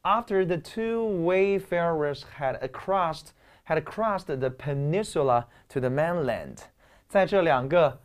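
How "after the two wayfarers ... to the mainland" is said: reading aloud slowly. Shown as a demonstration. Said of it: "所以这个句子要迅速反应".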